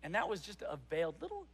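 ordinary talk, nothing marked

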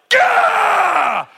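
high, loud mock shriek with a laugh; cartoon spider's laughing yelp; spider's startled cry. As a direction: voice the spider yelping in shock. Spider reacts to thrown commentary.

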